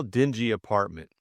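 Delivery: usual speaking voice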